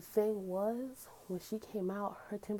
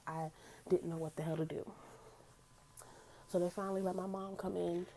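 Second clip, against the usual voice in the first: usual speaking voice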